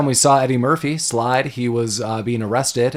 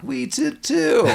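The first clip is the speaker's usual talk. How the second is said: mock high voice; 13 semitones higher, slower than usual. Mocking the robbery crew's reply.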